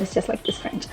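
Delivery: ordinary delivery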